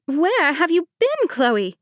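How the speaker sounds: high, loud, slow demand; demanding bride. A narrator's reading. Bride Mercedes confronts late cousin Chloe, demanding. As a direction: insist on an answer, stretched out. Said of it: "Mercedes demanded".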